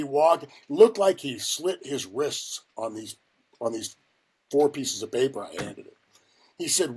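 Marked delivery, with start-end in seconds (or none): none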